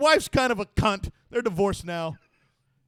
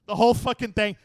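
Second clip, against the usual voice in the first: ordinary talk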